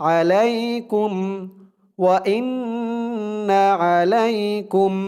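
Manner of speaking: slow, even chant of the phrase; Quran recitation. Shown as a demonstration. Teacher reciting the verse for students to repeat, slow chant. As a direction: draw out the words as recitation.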